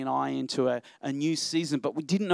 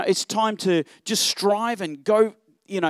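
ordinary delivery

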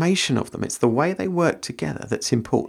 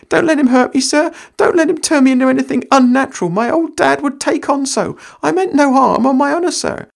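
high, loud fast panicked plea; Sam quaking; 13 semitones higher, 6 dB louder than usual. Acted. Sam begging Frodo not to let Gandalf hurt him, frantic and high. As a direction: beg in a high terrified rush. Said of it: "cried Sam, quaking".

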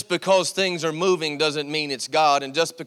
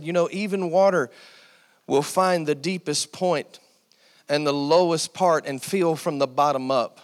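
ordinary talk

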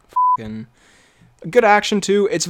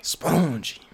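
softer mock French accent; terrible French accent; 5 dB quieter than usual. Echoing the co-host's catchword, soft mock French accent. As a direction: echo it with a bad French accent. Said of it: "That was a terrible French accent".

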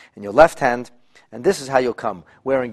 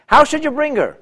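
much higher, louder question; rhetorical question; 7 dB louder than usual. Real, not acted. Teacher to students, high rhetorical question. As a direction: lift it up to the class.

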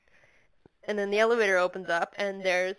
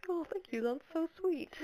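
touched; 8 semitones higher, 6 dB quieter than usual. Retold or imitated. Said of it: "he's like".

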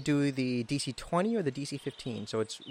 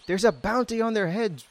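screaming; 7 semitones higher, 3 dB louder than usual. Acted. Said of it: "while he screams".

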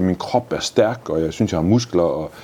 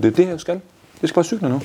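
excited high burst; 11 semitones higher, faster than usual. Real, not acted. Excited about cycling freely.

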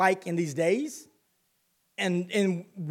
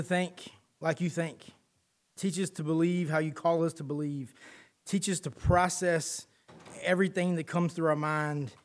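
quiet prayer; 4 dB quieter than usual. Acted. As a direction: pray it gently and humbly. Pastor leads a short prayer.

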